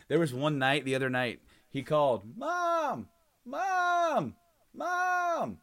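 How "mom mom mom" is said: high calling yell, Mom drawn out; yelling across the room. Retold or imitated. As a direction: call out across the house, kid style. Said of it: "he can yell across the house".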